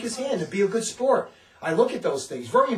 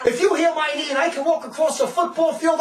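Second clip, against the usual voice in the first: higher, louder taunting voice; mocking challenge; 8 semitones higher, 5 dB louder than usual. Imitating a skeptic who challenges the preacher. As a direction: dare him like a skeptic. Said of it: "he says".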